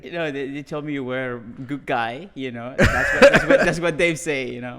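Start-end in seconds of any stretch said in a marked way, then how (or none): 2.8-4.5 laughing hard as the words come out; real laughter breaking up the words. Real, not acted. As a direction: talk through loud, high laughter. Laughing while teasing about references.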